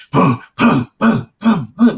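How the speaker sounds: imitated heavy footfalls. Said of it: "you can hear him just".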